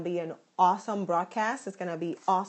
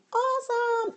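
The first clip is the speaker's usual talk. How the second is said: very high held singsong note; excited, drawn-out high singsong; playful hype. Real, not acted. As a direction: sing the word out high. Hyping the coming broadcast.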